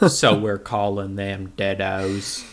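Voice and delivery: low, soft and slow, flat deadpan; flat bored deadpan. Retold or imitated. Imitating flat bored delivery.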